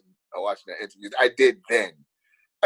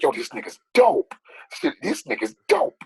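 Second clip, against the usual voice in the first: mildly excited, higher-pitched reaction; retold amazement; 6 semitones higher than usual. Fan retells rewinding an actor's scene, mildly amazed. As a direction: marvel at it, pitch climbing on the repeat. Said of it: "I'm like".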